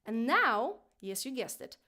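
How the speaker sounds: normal talking voice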